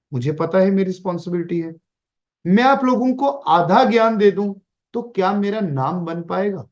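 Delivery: ordinary talk, nothing marked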